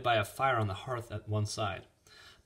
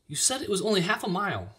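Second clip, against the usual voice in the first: put-on high girlish nudge; high-voiced young girl pressing; insistent reminder; 10 semitones higher than usual. A narrator's reading. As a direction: remind him pointedly, pitched up. Girl presses hesitant farmer.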